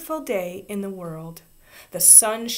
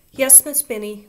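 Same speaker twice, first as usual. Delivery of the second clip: higher, louder chirpy yes; cheerful reply; 6 semitones higher, 4 dB louder than usual. A narrator's reading. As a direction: answer brightly and skip off. Ramona answers teacher brightly.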